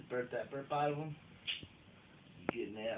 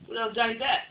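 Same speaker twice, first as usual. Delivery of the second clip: much louder and higher playful call; excited shout; 9 semitones higher, 13 dB louder than usual. Real, not acted. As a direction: shout it out gleefully. Joking banter with his wife.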